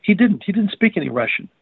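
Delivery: high rising question, then an emphatic denial; surprised. Retold or imitated. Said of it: "looked at me surprised".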